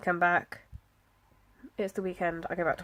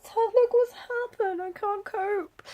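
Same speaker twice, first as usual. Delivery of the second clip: much higher, slow, hammed-up wail of despair; mock despair; 13 semitones higher, 4 dB louder, slower than usual. Telling her husband about the ruined planner, in a high, slow, hammed-up wail. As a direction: play it as a melodramatic meltdown, drawn out and high. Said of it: "went in to see my husband and was like".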